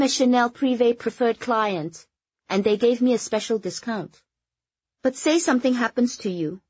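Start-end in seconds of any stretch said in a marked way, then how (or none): none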